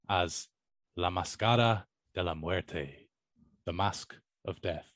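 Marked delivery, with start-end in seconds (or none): 1.0-3.1 "La Máscara de la Muerte" in Spanish, "Máscara" as MAS-ka-ra, "Muerte" as MWER-te; Spanish pronunciation. Shown as a demonstration. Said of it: "pardon my, uh, Spanish, Spanish pronunciation".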